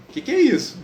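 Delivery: startled at the mark on his face; worried friar. Retold or imitated. Friar Leo alarmed at the spot on Francis's face, high and loud. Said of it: "o Frei Leão fica preocupado".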